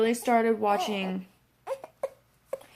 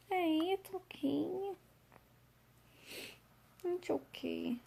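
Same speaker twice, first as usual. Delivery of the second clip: soft soothing; 8 semitones higher, 11 dB quieter than usual. Real, not acted. Soothing after tearing up.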